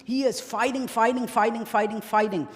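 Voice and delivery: loud, emphatic sermon delivery; impassioned preaching. Acted. Preacher recounting the battle to mourners, impassioned. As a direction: hammer out the battle story with rising passion.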